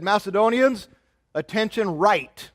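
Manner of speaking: loud military order, slow on attention; barked command. Acted. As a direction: call the troops to order. Alexander commands his troops.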